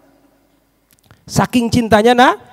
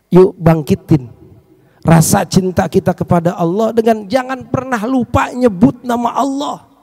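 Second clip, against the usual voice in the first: ordinary talk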